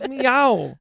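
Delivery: imitating a cat's meow. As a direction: mimic the cartoon cat. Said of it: "he goes".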